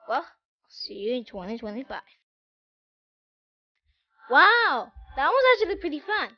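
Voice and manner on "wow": ordinary talk, nothing marked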